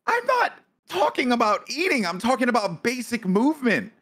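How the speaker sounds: high, insistent correction; real exasperation. Real, not acted. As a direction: argue back in exasperated high pitch. Exasperated reply to friend's teasing.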